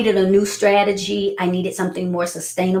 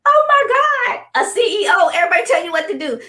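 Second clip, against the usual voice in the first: much higher, giddy through tears of laughter; laughing disbelief; 7 semitones higher than usual. Retold or imitated. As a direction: squeal it like you can't believe him. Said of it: "I was like".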